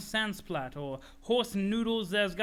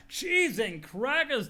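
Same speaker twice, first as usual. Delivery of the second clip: folksy exclamation; 6 semitones higher, 5 dB louder, much slower than usual. Acted. Jake voice exclaiming in character.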